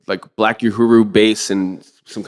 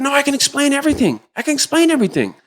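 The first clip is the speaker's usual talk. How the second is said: high mock pleading. The adviser begging.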